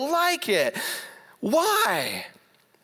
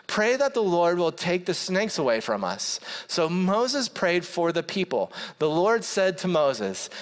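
steady, lower-pitched Bible reading; scripture reading; 4 semitones lower than usual. Acted. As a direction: read the passage evenly, like from the pulpit. Reads scripture passage.

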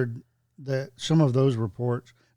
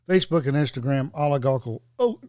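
ordinary talk, nothing marked

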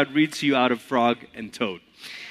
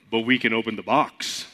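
lightly lowered Toad voice; a hint of a lower frog character; 6 semitones lower, 4 dB quieter than usual. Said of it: "Toad has to have a lower voice".

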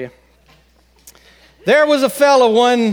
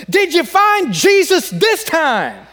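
higher and slow, fed up, hammering each word; the preacher at the end of his rope; slow exasperated demand from a preacher; 9 semitones higher, slower than usual. Retold or imitated. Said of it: "Exasperated now".

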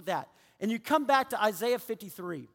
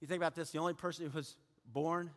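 quiet closing sermon; 7 semitones lower, 8 dB quieter than usual. Acted. Pastor bringing the sermon to a close, softly. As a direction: draw them in with a hush.

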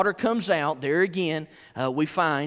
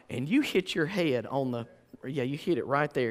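ordinary talk, nothing marked

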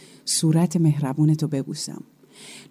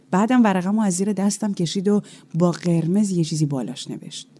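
ordinary delivery

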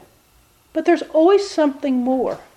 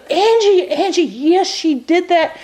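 higher, emphatic voice calling Angie; the lawyer firmly confirming the gossip; 5 semitones higher than usual. Retold or imitated. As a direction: insist it's true, pitched up. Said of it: "And she goes".

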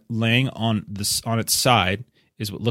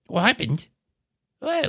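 high, pleading hobbit voice, much higher; panicked hobbit; 13 semitones higher than usual. Acted. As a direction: beg like a frightened little hobbit. Hobbit answers Gilly.

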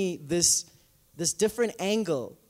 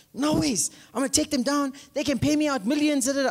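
fired-up sister; 6 semitones higher than usual. Retold or imitated. Sister insisting on suing.

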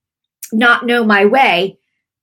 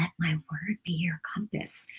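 hushed awed whisper; 16 dB quieter than usual. Retold or imitated. Host retelling words heard half-awake at night, whispered.

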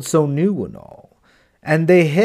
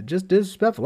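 ordinary delivery

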